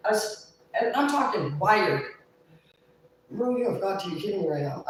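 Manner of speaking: ordinary delivery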